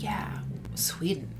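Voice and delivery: a hushed, drawn-out retold reaction; mock whispered awe. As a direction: breathe the word out in hushed wonder. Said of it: "I was like".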